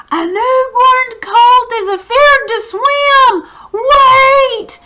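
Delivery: high, loud urgent shout; read-aloud fisherman calling out. Acted. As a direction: yell the news from the piling. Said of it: "began shouting in a hoarse voice".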